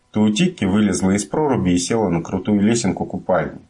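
plain reading voice

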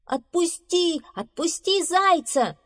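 high, urgent repeated cries; shouting. Acted. Friends bursting in to free hare, shouting. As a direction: shout the demand high, stretching it out. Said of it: "кричали они".

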